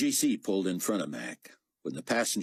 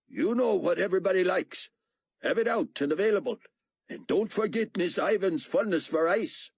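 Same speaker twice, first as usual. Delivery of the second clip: raised, lightly voiced exchange; higher voices for the officer and the steward; character voices; 11 semitones higher than usual. A narrator's reading. Akbar giving orders to the steward, lightly voiced.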